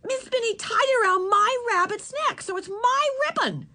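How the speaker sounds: rising indignation. Acted. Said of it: "her voice rising".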